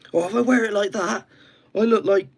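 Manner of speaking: high, loud Welsh voice; Welsh accent. Acted. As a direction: go high and sing-song Welsh. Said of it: "I do a Welsh accent".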